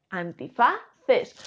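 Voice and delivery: "antifaces" muy lento, partido antes de "ces"; énfasis en la ce. Shown as a demonstration. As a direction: separa "antifaces" para resaltar el final "ces". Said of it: "Con C". ("antifaces" estirado, destacando la última sílaba)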